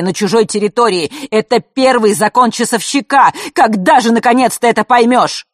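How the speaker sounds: loud, high, furious lecture; angry scolding. A narrator's reading. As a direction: tear into her, rising to a shout. Father continues scolding. (отец гневно отчитывает дочь, переходя на крик)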